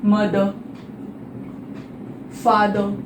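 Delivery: मदर, फादर each ending on a soft schwa; silent final R. Shown as a demonstration. Teacher modelling the correct reading for students, silent final R. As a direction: say "muh-dhuh", pause, "faa-dhuh", no R. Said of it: "इसमें हम directly कैसे पढ़ते हैं".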